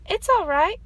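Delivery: high, loud reply; shouting back. A narrator's reading. Fox shouting back to his mother from the tree, loud. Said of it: "Fox shouted back".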